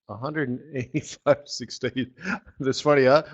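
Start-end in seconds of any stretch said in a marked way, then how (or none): none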